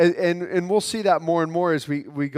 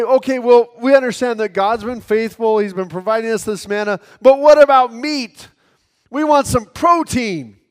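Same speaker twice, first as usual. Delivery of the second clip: loud, higher grumbling demand; complaining; 5 semitones higher, 7 dB louder than usual. Pretend. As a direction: whine for more like a spoiled crowd. Preacher voices complaining Israelites.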